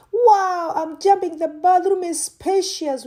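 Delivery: high, loud squeal of delight; jumping with joy. Real, not acted. Finding the bathroom in her new home, thrilled squeal. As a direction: bounce with excitement on every word.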